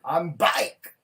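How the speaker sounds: Bambi's accent hammed up, loud, BIKE shouted out. Teasing friend's catchphrase, loud mock Bambi accent. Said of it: "she need to stop saying bike".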